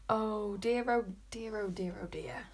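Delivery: soft, fading worried murmur; dismayed muttering. A narrator's reading. Follower hearing of the witch hunt, muttering in dismay. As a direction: let each repetition sink nearly to a whisper.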